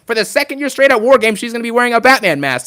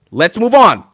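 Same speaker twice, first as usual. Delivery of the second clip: ordinary talk, nothing marked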